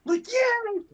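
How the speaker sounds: very high squealed yay; squealing yay. Acted. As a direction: squeal with delight. Cheering at adorable bathing scene.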